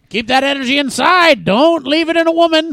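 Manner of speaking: high, loud hammed-up coach; mock coach shouting. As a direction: bellow it like a crazed coach. Voicing the coach.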